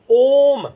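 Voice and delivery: ओम drawn out long and loud, the ओ held; prolonged Om. Shown as a demonstration. Teacher demonstrating ओम drawn out long for students. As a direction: stretch the ओम for a pluta vowel. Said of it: "तो आप कैसे बोल रहे हैं".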